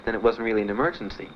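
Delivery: ordinary talk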